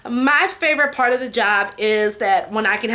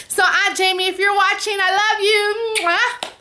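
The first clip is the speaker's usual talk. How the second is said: loving shout-out; 7 semitones higher than usual. Real, not acted. Affectionate shout-out to her aunt.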